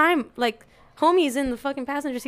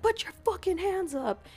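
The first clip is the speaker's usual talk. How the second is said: high-pitched mock yell, kept soft; high, restrained mock command; retold cop yell; 9 semitones higher, 4 dB quieter than usual. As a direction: imitate a cussing cop's order. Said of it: "they're cussing".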